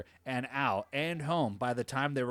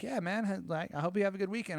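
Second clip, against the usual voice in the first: high boss voice; 8 semitones higher, faster than usual. Retold or imitated. Boss says goodbye, quoted.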